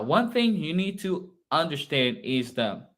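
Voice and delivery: usual speaking voice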